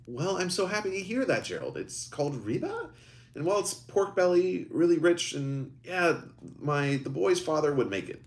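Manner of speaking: lightly voiced mother; mother Patricia. Mother character voiced in story.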